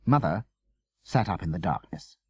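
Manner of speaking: ordinary delivery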